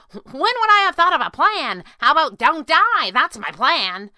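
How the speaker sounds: high, loud incredulous outburst; exasperated disbelief. A narrator's reading. Squirrel retorting to her boss, disbelieving outburst. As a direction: cry out incredulously, slowing at the end. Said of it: "she cried in disbelief".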